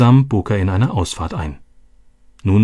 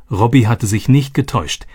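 ordinary delivery, nothing marked

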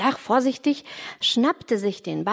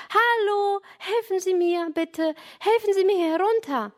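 calling for help; 9 semitones higher than usual. A narrator's reading. Boy on the roof calls to passing cyclist, high pleading call. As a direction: call out for help in a high voice, without real shouting. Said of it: "Jürgen rief schnell".

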